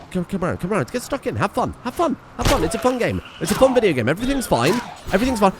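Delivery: high, swooping pep talk; panicky self-reassurance. Real, not acted. Player insisting the game is fun, mildly panicked. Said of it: "I'm panicking a little bit".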